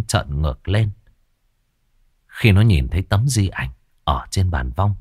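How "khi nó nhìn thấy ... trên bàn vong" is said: usual speaking voice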